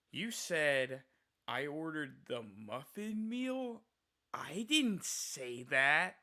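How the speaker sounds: put-on slow, drawn-out indignant old man; loony old customer. Cashier imitates the glaring old man, slow and indignant. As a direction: stretch it out, offended and baffled. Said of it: "acting like a fucking loony".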